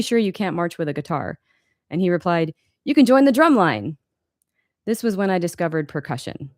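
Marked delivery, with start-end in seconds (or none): 2.8-4.0 high, louder, enthusiastic teacher; upbeat encouragement. Acted. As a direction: pitch it up like an eager suggestion. Guitar teacher's encouraging suggestion, voiced.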